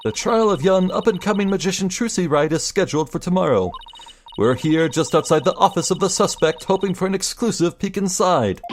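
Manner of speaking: bright on-the-scene news read; news reporter. Acted. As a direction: report live from outside the office.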